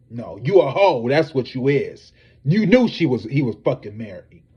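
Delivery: loud, scornful name-calling; loud rant at a cheating mistress; angry call-out. Real, not acted. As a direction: shout her down with contempt. Calling out Monica Lewinsky.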